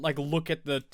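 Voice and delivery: ordinary delivery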